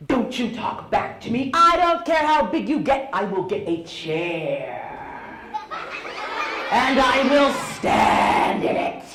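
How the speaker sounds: mother's threat building to a loud shout; mock furious mom scolding tall sons; angry mother. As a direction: start stern, end yelling. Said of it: "she would say things like".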